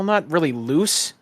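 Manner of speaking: normal talking voice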